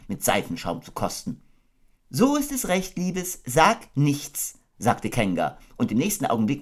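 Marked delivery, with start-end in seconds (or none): 2.1-4.6 high, gentle mother kangaroo voice; motherly Kanga. Acted. Kanga tucking her baby into bed, motherly. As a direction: coo it like a doting mother.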